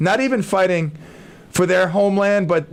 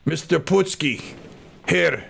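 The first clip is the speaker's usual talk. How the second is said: colonel; 3 semitones lower, 4 dB quieter, slower than usual. Acted. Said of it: "And he's like".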